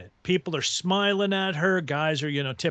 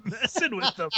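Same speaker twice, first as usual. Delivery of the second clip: ordinary talk, nothing marked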